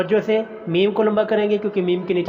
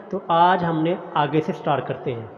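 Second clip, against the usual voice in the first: ordinary talk, nothing marked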